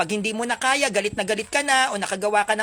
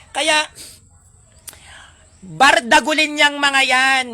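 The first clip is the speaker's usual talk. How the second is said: forceful preaching; 5 semitones higher, 7 dB louder, slower than usual. Acted.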